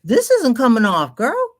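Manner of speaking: loud, high exasperated complaint. Real, not acted. Upset about lipstick.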